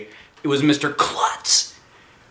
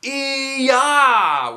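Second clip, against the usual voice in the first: half-hearted high yell; a timid karate cry; weak group kiai; 14 semitones higher, 4 dB louder than usual. Acted. Kids screaming as a team, a weak high kiai. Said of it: "we all screamed".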